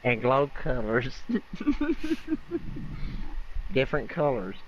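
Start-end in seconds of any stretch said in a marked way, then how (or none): 1.2-3.7 high breathy giggle; laughing. Real, not acted. Amused by the glowing colors.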